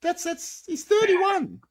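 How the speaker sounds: high-pitched, incredulous outburst. Real, not acted. Podcaster can't believe the manager's age, high-pitched and incredulous. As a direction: voice leaps right up in disbelief.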